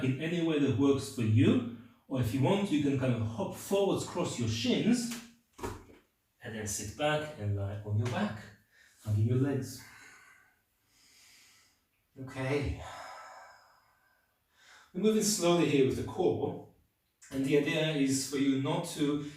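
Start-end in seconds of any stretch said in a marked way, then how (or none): none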